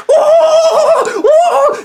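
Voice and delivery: very high, loud squeal of laughter; shrieking laughter. Real, not acted. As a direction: burst into a shrieking cackle. Cracking up at his own impression.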